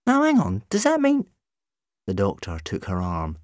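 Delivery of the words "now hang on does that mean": high, loud, incredulous; sudden dawning alarm; startled realisation. A narrator's reading. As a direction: interrupt in high-pitched surprise. Rose reacting to revelation.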